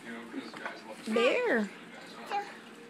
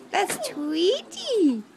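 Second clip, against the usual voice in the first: slow teaching; 4 semitones higher, much slower than usual. Shown as a demonstration. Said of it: "teaching toddler a new word".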